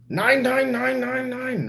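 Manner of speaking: high-pitched, rapid-fire comic "nein"; mock German refusal. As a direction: chant it high on one held note, as a joke German. Mock German answer to question.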